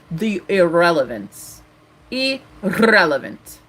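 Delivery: the irrelevance, drawn out slowly; slow emphatic. Real, not acted. Dismissing her mother, slow and drawn out. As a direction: stretch out each syllable of irrelevant. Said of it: "repeating for emphasis".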